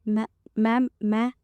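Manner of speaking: a faltering, hesitant stammer; a touch of nerves; nervous stammer. A narrator's reading. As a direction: stumble on the word ma'am. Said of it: "थोड़ा nervous होकर बोलती है".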